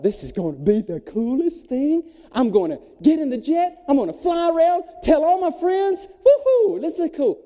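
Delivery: rising, excited imagined thought; imitating his own boyish excitement; giddy anticipation.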